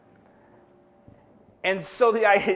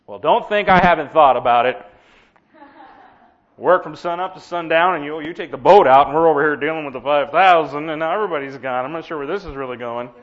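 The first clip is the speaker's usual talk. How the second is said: Peter griping to Jesus, louder; grumbling Peter; 5 semitones lower, 8 dB louder than usual. Retold or imitated. Peter complaining to Jesus after the crowd leaves, louder gripe. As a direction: air every complaint like a tired fisherman. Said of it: "the conversation went something like this".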